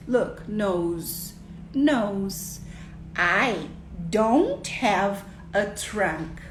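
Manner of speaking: stretched, word-by-word English for a little learner; slow pointing speech. Shown as a demonstration. Explaining trunks and noses to a child, very slowly. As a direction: spell it out slowly, with pauses between phrases. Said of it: "falando pausadamente na primeira vez".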